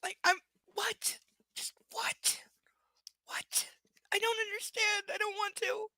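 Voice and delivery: high, small-voiced confusion; baffled disbelief. Real, not acted. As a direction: sound lost and appalled.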